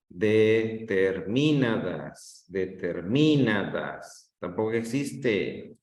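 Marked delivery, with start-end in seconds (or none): 0.1-4.3 "Determinadas" lento, con el acento en MI: deterMÍnadas. Shown as a demonstration. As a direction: alarga y carga MI en "Determinadas". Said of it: "O la pongo en mi". ("Determinadas" con el golpe de voz en MI)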